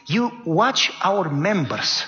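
normal talking voice

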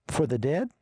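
"For the dead?" set apart, rising on "dead"; "For the dead?" as its own separate question; question intonation. Shown as a demonstration. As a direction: lift "dead" into a clear question. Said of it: "Question mark".

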